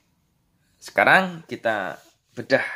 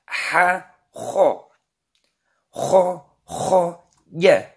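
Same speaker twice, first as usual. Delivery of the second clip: higher, louder drill recitation; reading drill; 6 semitones higher, 4 dB louder, much slower than usual. Shown as a demonstration. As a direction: chant each syllable for pupils to repeat. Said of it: "Halaman dua belas dibaca".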